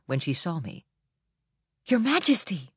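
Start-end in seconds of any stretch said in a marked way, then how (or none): 1.9-2.7 high, breathy, thrilled gasp; breathy and delighted. A narrator's reading. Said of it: "she breathed, delighted".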